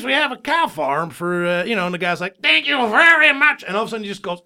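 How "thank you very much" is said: put-on high, loud Fantasy Island accent; talking cow with a loud Tattoo accent; Tattoo's accent. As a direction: exclaim thanks in a hammed-up Fantasy Island accent. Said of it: "He had a little accent".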